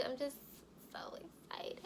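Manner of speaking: crying whisper; barely voiced, tears choking the words; whispered tearful. Real, not acted. Getting emotional ending her pregnancy video, crying whisper.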